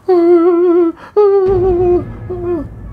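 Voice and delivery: put-on a shrill, high wail from the possessed girl; high wailing cry. The possessed girl wailing while being scolded, high eerie wail. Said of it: "Dia semakin nangis".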